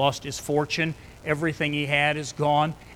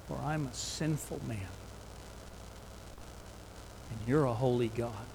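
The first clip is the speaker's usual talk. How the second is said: soft, low, hushed sinner's confession; humbled confession; 3 semitones lower, 10 dB quieter than usual. Acted. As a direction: confess it quietly, broken before God. Peter humbled, quiet confession.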